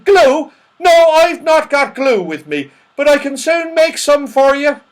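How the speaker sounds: put-on high, loud, muddled old man; saucepan man. Saucepan man offering to make glue, muddled and loud. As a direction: answer loudly as if hard of hearing.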